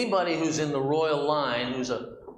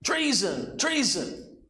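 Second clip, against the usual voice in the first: high-pitched retold cry of alarm; imitated yelling; 10 semitones higher, slower than usual. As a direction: cry out like a queen betrayed, without full volume. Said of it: "she comes running out yelling".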